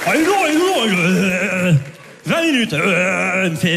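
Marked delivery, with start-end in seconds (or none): 0.1-0.6 high-pitched reproachful whine; mock scolding. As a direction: wag a finger at Ludo. Clerk scolding retold.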